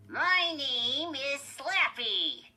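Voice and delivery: high, loud, slow ventriloquist's dummy; Slappy. A narrator's reading. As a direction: introduce yourself in a squeaky puppet voice. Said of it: "Lindy made the dummy say".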